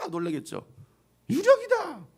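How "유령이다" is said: high, strained shriek of fright; frightened cry. Acted. Preacher imagining people seeing Jesus, scared shriek. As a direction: shriek it like you saw a ghost.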